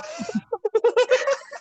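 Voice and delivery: high, loud giggling laugh. Real, not acted. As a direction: laugh it off at yourself, pitching up high. Laughing at herself.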